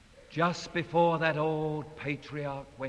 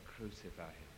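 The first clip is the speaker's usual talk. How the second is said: much softer, low murmur; parents' voice; 9 semitones lower, 16 dB quieter than usual. Retold or imitated. Parents urging, soft low murmur. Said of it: "voices of parents saying".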